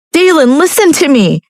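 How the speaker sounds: high desperate call; calling after him. A narrator's reading. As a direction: shout his name as he leaves. Chasing him.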